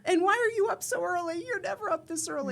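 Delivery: high, loud incredulous husband voice; playfully imitating a stunned husband; husband's mock disbelief. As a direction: ham up the husband's shocked disbelief. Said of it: "you have to know my husband is this very pan face expression".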